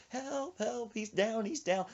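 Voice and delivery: high, half-hearted mock yell; small high-pitched pretend distress call; fake cry for help. Lying to friend about calling help, faked feeble yell. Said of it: "I was, like, yelling for help".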